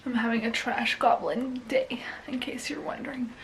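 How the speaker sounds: whispered aside, almost no voice; soft secret whisper to the camera. Real, not acted. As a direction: confide it in a breathy hush.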